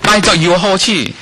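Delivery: hammed-up eager shopper; playing the bargain hunter to the congregation; mock bargain excitement. Acting out a bargain rush.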